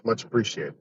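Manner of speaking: usual speaking voice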